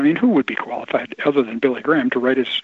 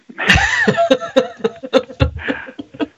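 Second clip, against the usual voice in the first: a high, bursting laugh at the punchline; hearty laugh; 4 dB louder than usual. Real, not acted. Guest laughing at the end of his barbershop story, a hearty burst. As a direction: let a big real laugh break out, rising.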